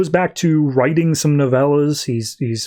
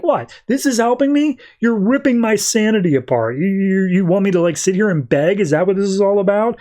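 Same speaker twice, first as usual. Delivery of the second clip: high-pitched, outraged questioning; indignant disbelief; 6 semitones higher than usual. Retold or imitated. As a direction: act out an incredulous retort without shouting. Stranger argues angrily with God.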